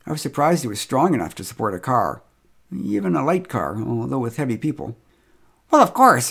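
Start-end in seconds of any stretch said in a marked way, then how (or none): 5.7-6.3 high, loud exclamation; loud, high-pitched of course; exclaimed. A narrator's reading. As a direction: fling it out like it's obvious. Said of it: "exclaimed Marcia".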